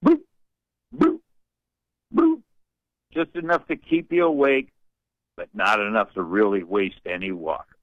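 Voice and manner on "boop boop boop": imitated dripping faucet. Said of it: "a dripping, leaking kitchen faucet".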